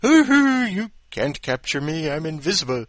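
very high, loud whoop easing into a taunt; mock triumphant whoop. Ring thief taunting onlookers he can't be seen, loud whoop. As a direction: crow like a fool who thinks he can't be seen. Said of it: "thinking he turns invisible".

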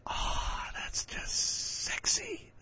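breathy whisper. Acted. Host reacting to steamy story.

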